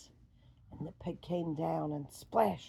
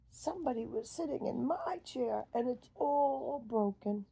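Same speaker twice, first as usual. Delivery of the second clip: higher, upset little bear voice; Baby Bear; 6 semitones higher, 3 dB louder than usual. Acted. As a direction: pitch up and complain.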